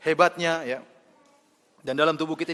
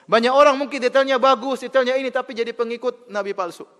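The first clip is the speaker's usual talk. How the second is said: louder and higher, hammering each phrase; forceful preaching; 9 semitones higher, 8 dB louder than usual. Acted. Preacher scorning clever idol worshippers, loud and rising. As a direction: build up the outrage before the punchline.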